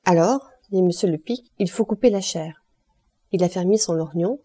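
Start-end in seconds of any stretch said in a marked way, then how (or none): none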